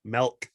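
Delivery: "Milk" said as melk, with a short e instead of i; Michigan melk. Shown as a demonstration. Ribbing Michigan speech to a friend, "Milk" as melk. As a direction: say "Milk" like melk, open e vowel. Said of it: "M E L K, milk".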